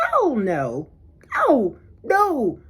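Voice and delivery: loud, swooping indignant no; indignant, punched refusal; emphatic refusal. Retold or imitated. Emphatic refusal in retold inner retort.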